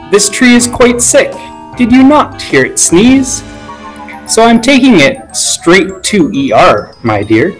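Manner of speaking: lightly voiced smooth liar to a child; appeasing. A narrator's reading. Covid explaining the sick tree to the child, appeasing. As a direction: reassure the child with a quick fib. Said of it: "he tried to appease".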